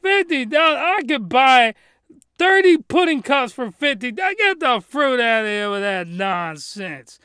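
loud, high, outraged rant; indignant rant. Retold or imitated. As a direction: rant at the web host. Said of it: "I'm like".

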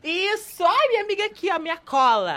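loud, high burst of delight; excited recognition. Real, not acted. Dancer thanks friend for jogging memory, excited outburst. Friend helps recall forgotten name.

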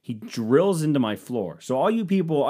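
ordinary delivery

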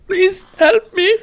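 slow, very high falsetto pleading; mock a helpless player begging, drawn out; imitated plea for help. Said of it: "he genuinely went".

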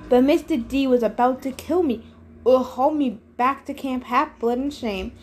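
ordinary delivery, nothing marked

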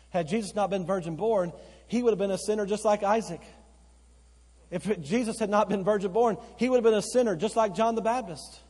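high and loud, pressing argument; fiery preaching. Acted. Preacher arguing the virgin birth to the pews, loud and rising. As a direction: drive the point with rising force.

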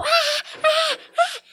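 high, cried-out mock screams; imitated screaming. Retelling the girl's screams to friends, a high imitated wail. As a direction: mimic her panicked screams, very high. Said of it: "ela começou a gritar".

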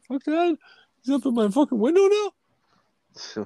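very high-pitched squeaky cat voice; talking cat. Acted. Cat complaining about an intruder at its window, high squeaky voice. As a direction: voice an indignant cat in high falsetto. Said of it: "He's like".

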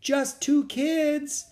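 very high, drawn-out little voice; small voice. A narrator's reading. Girl answering the captain from hiding, in a small voice. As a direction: squeak it out slowly and timidly. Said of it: "answered in a small voice".

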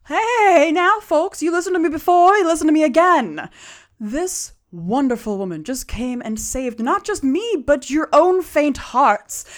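high, loud showman's oratory; rallying a crowd. Acted. Halfling talking down a booing crowd, rallying speech. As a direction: win the crowd over, drawing out the big words.

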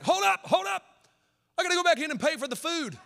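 a mock dad halting everyone; dad's urgent stop. Dad stops the car.

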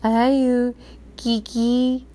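put-on high little toddler voice; lightly voiced small boy, tender; small child voice. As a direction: say it like a toddler learning words. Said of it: "to Katie, he's like".

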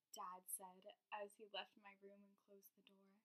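soft, hushed storyteller voice; playful spooky voice. Acted. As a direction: read it low, like a campfire tale. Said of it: "my serious, spooky voice".

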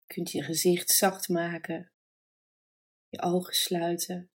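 calm, unhurried meditation voice with long pauses; guided meditation. Acted. Yoga nidra teacher guiding listeners to relax and breathe, calm meditation voice. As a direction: guide them gently into rest, leaving space between phrases.

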